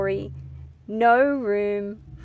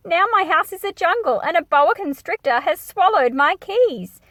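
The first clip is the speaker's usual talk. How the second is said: higher and louder, comic alarm; mock dismay; 8 semitones higher, 6 dB louder than usual. Missus Fizzletum explaining to Christopher why she left. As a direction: tell the jungle disaster with flustered glee. Old lady character exclaims.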